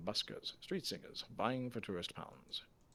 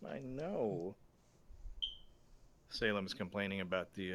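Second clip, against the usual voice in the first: ordinary talk, nothing marked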